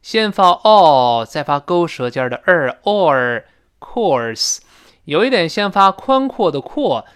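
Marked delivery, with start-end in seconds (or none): 0.0-4.6 very slow course, o then curled r; slow course demo. Shown as a demonstration. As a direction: stretch out course, vowel then r. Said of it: "先发O再发勾舌尖的儿".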